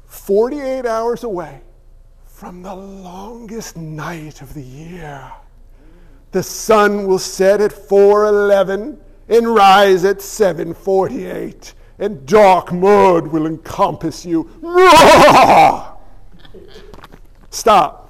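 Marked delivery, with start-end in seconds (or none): 2.2-14.5 slow, dark doom voice; ominous announcer. Pretend. Ominous mock forecast. 14.5-17.0 big burst of laughter; loud laugh. Real, not acted. Laughs at own bit.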